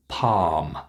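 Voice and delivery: "palm" said pahm, long vowel. Shown as a demonstration. Teacher models word for practice, long ah in "palm". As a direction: hold the ah in "palm". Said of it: "It's the long ah sound".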